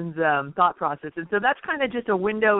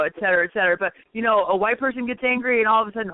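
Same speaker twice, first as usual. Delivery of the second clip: ordinary talk, nothing marked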